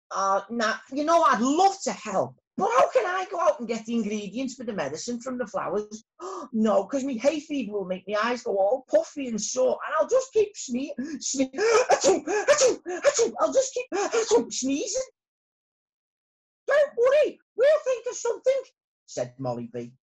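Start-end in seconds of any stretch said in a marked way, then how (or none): 0.1-9.8 worried bee voice. Acted. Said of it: "Arthur worried about hay fever". 9.8-15.1 sneezing. Acted. Arthur sneezing mid-sentence. 16.6-18.6 very high, bright little bee. Acted. Molly reassures Arthur.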